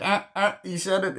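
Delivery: very high, squeaky-pitched mock excitement; mock excited recognition. Film fan at the title drop, high mock excitement. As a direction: jump up high as if spotting it.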